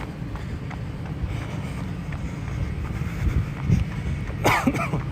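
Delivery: choking cough; real coughing, clearing the throat; coughing fit. Real, not acted. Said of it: "Choking on my spit".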